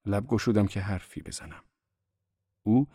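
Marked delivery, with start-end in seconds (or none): none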